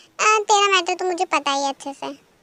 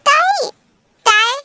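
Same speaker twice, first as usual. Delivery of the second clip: high, loud call to wake her; 7 semitones higher, 6 dB louder, slower than usual. Acted. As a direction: shout her awake. Teacher wakes sleeping student. (ऊँची, ज़ोरदार पुकार)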